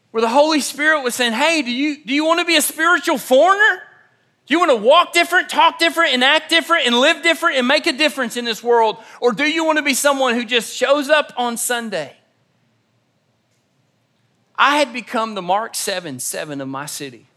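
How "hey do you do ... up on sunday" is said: preaching challenge. Acted. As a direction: hammer the challenge home to the crowd. Holy Spirit challenge, preached.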